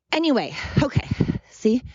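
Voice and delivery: winded from walking up a hill; breathless, panting between words; out of breath. Real, not acted. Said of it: "I'm out of breath".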